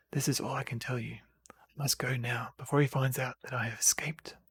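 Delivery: a conspiratorial whisper to the hero. A narrator's reading. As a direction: hush it like a secret that must not be overheard. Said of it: "lowers her voice".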